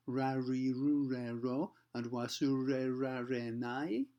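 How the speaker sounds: "Ra ri ru re ro" and "Wasure ra re nai" slow, each R curled English style; very slow foreigner's R, curled on every syllable. Retold or imitated. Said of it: "the foreigner who might say".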